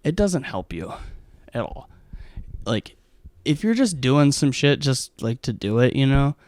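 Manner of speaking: ordinary talk, nothing marked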